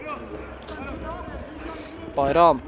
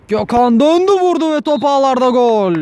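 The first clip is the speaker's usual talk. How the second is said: screaming football commentator; 8 semitones higher, 6 dB louder than usual. Real, not acted. Goal scored, commentator erupts.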